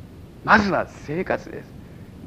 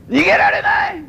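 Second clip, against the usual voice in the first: a much higher, louder cry of mock panic; mock panicked shout; 5 dB louder, slower than usual. Pastor jokes to church he can't escape, hammed-up panicked shout. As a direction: yell it like a trapped man, hammed-up.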